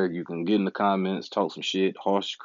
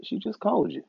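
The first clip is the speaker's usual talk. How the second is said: higher, berating himself by name; scolding inner voice; 8 semitones higher than usual. Retold or imitated. Scolding himself for not having the right equipment, higher mock lecture. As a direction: lecture yourself like a fed-up critic. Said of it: "it's like, [name]".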